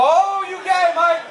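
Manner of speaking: high, drawn-out "oh" of gotcha surprise; mock shocked accusation. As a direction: shoot way up in pitch, hammed-up discovery. Catches Mike leaning in.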